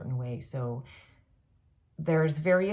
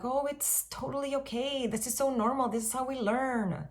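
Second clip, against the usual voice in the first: higher, gentle reassuring voice; kind reassurance; 8 semitones higher than usual. Retold or imitated. Reassuring a friend who made a mistake, higher and gentle. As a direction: soothe a friend who slipped up. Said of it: "I would be like".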